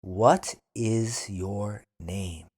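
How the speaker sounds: What is your name? slowly, What stressed; slow teacher model of What is your name?. Shown as a demonstration. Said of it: "then repeat slowly".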